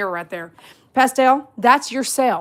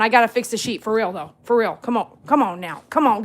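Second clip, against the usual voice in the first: usual speaking voice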